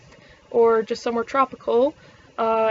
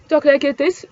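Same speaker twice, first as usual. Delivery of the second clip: Gatineau accent retry; 3 semitones higher, 7 dB louder, slower than usual. Shown as a demonstration. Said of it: "I'm fucking it up".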